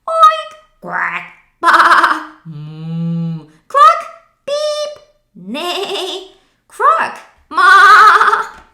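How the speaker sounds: high, loud string of animal calls; animal chorus. Acted. The animals cheering as they jump into the truck. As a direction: rattle off each animal's call. All animals answering at once.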